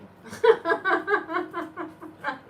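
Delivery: loud, high laughing fit; can't stop laughing. Real, not acted. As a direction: crack up at your own joke. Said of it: "I can't stop laughing".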